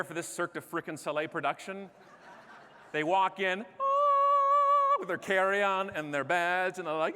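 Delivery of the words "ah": high, loud sustained "ah"; a soaring choir note for a grand entrance; sung grand entrance. Acted. Flight crew making a Cirque-style entrance, sung high.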